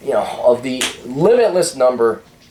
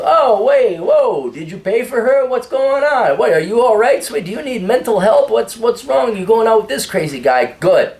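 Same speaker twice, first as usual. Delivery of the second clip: much higher, incredulous teasing; the guys teasing his date in mock disbelief; mock shocked friends; 9 semitones higher than usual. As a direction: friends ribbing him in mock alarm. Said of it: "if they don't loop you into it".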